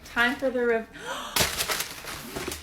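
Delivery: ordinary talk, nothing marked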